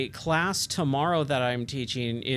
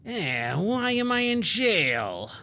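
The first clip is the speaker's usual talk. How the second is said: slow, higher, dopey big lug voice; dim slow giant; 6 semitones higher, much slower than usual. Shown as a demonstration. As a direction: drag it out like a dim-witted giant. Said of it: "a voice for this character".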